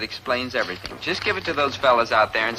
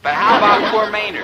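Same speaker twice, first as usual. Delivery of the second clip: laughing; 7 semitones higher, 4 dB louder than usual. Acted. Son laughing, higher pitch.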